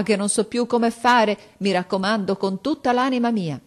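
mother begging the headmaster to take her son back; desperate pleading. A narrator's reading. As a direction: implore the headmaster on your knees. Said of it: "giungendo le mani e supplicando".

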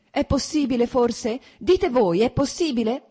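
an outraged wife raising her voice. A narrator's reading. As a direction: demand an answer, voice rising. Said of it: "Ripeté alzando la voce".

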